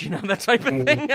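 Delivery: laughing through the words; amused laugh. Real, not acted. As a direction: chuckle while saying it. Laughing at his own retelling.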